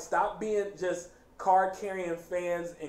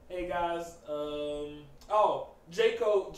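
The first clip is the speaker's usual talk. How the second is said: ordinary talk, nothing marked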